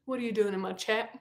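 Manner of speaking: soft, low, rising question; hushed questioning. Pretend. Streamer questions Tom Riddle in her chat, hushed disbelief. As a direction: ask it quietly, as if taken aback.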